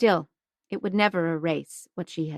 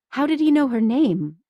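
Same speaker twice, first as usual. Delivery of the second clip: ordinary delivery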